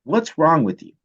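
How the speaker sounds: mock yell, high on the name; retold yell. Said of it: "he yells at me".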